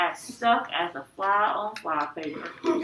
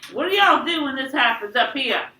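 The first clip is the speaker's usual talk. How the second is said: Southern style; 5 semitones higher, 6 dB louder than usual. A narrator's reading. Worried Mama questions Dad, Southern accent. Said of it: "Mama started talking Southern style".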